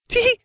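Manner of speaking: very high "Tee-hee" squeaked with a rise; airheaded high-pitched giggle; silly giggle. A narrator's reading. Farfelu giggle.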